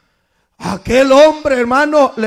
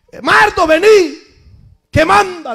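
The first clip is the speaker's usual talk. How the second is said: put-on old folks calling; 8 semitones higher, 4 dB louder than usual. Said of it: "como nosotros los que estamos viejos ya le decía".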